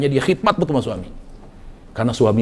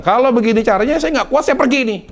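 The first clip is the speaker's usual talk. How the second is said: husband's threat, voiced much higher; high-pitched mock threat to leave; threatening husband; 10 semitones higher, 3 dB louder, faster than usual. Husband threatening his wife that he will leave. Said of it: "Lalu dia bilang".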